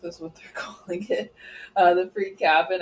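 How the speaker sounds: whispered words through a laugh; giggling while saying it. Real, not acted. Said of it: "I can't say that without laughing".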